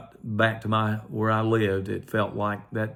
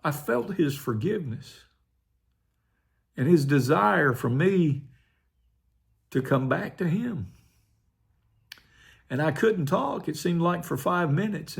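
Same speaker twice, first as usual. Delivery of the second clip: holding back tears; 6 semitones higher than usual. Real, not acted. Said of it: "I was trying not to cry".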